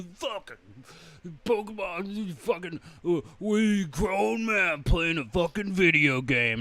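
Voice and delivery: high, drawn-out dopey voice; mocking hecklers. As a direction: imitate a stumbling, sneering passer-by. Mimics hecklers sneering at players.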